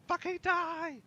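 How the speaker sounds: high, very loud panicked yell; desperate scream. Retold or imitated. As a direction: scream it as a desperate last stand. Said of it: "getting more and more desperate".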